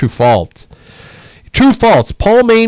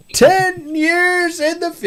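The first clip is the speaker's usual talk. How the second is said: slow, high announcer voice; game show host voice; 3 dB quieter, slower than usual. Pretend. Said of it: "I'll stop doing that".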